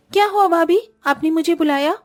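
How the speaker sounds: put-on meek young girl.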